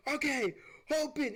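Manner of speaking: high, loud command; urgent shout. Retold or imitated. Telling friend to open the oven door, urgent high shout. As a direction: bark it as you charge the oven. Rushing with extinguisher.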